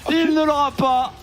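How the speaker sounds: excited caster. Real, not acted. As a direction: call the chase in rising hype. Co-caster hyped over pentakill. (commentateur survolté, voix aiguë)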